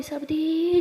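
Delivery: ordinary delivery, nothing marked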